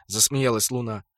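ordinary delivery, nothing marked

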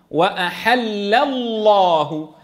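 slow connected "وأحل الله", thin lam flowing into a thick one; drawn-out recitation of "وأحل الله", keeping the two lams distinct; light lam, heavy lam. Shown as a demonstration. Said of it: "تبقى مرققة".

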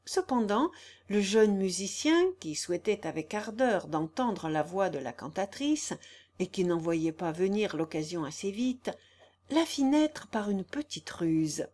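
ordinary delivery, nothing marked

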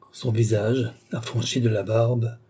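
ordinary delivery

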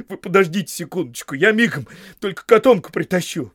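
high, loud, excited burst; eager, agitated plea; flustered eagerness. A narrator's reading. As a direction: jump in before he leaves without you. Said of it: "вскинулся Сэм".

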